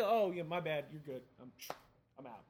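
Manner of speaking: breezy mock apology, trailing down; casual backing off. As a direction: toss it off and bow out. Mimics someone casually backing off.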